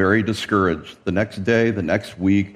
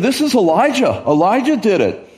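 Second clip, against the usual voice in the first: much higher, whiny accusation; blaming tattletale; 8 semitones higher than usual. Acted. King blames Elijah to Jezebel, high-pitched tattling. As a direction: pin the blame in a high, pleading voice. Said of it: "That's not how he said it, right? He said".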